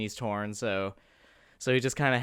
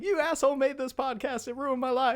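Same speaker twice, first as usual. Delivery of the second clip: mock angry fan; 10 semitones higher, 5 dB quieter than usual.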